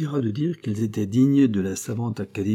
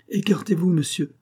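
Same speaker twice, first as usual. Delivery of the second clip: higher, sharp command; shouted order; 9 semitones higher than usual. A narrator's reading. Commissioner ordering Courcoran to step aside, higher shout. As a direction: order him out of the way. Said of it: "cria le commissaire".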